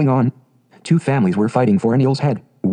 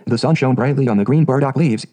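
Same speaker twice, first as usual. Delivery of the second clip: ordinary delivery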